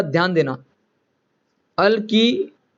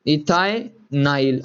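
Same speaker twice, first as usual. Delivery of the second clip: slow final answer; 4 semitones lower, much slower than usual. Shown as a demonstration. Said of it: "तो मैं लिखता".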